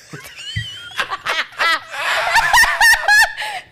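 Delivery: high, loud burst of laughter. Real, not acted. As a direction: cackle out loud. Host cracks up at prank.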